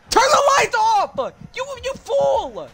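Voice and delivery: high shouted order, slow on the insult; angry yell. Real, not acted. Gamer yelling at a careless teammate, angry shout. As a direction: yell at a teammate to kill the light.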